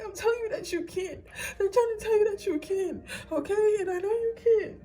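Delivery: high, tearful insistence; really emotional. Real, not acted. Reader telling viewers they can, really emotional. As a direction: urge them on through real tears. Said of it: "it's making me really emotional".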